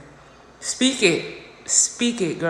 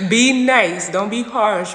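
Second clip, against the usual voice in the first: loud self-chiding; 7 dB louder, slower than usual. Real, not acted. Chides herself out loud, amid laughs.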